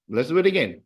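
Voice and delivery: ordinary delivery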